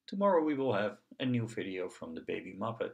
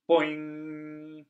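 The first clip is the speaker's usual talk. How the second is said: boing sound; 6 semitones higher, 5 dB louder than usual. Acted. Knee tap sound effect.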